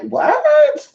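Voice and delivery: squeaky high shocked "What?". Retold or imitated. To a friend suddenly leaving, a high shocked what.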